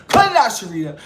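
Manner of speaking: high, laughing, teasing; laughing scold. Retold or imitated. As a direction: chide her while laughing. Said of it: "let's say he was like".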